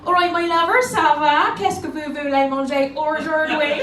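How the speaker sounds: French in a broad Bristol accent; loud put-on Bristol accent, drawn out at the end. Shown as a demonstration. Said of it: "Bristol, that's quite a good one".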